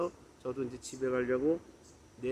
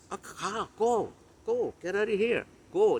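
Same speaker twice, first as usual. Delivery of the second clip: higher-pitched mock send-off; playful, short commands with raised pitch; joking dismissal; 5 semitones higher, much slower than usual. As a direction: shoo them away in jest. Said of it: "그건 이제 말을 농으로 그렇게 한 거죠".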